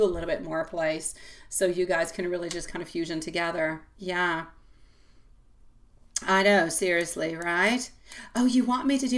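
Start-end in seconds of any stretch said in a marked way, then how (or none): none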